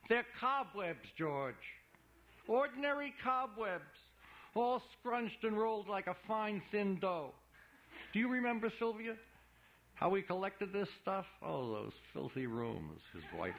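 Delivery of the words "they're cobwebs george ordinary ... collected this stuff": showman's voice. Acted. Imolati's showman voice for Sylvia.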